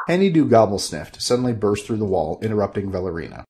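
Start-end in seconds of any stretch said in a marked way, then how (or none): none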